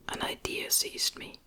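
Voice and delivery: ordinary delivery, nothing marked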